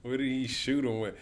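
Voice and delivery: usual speaking voice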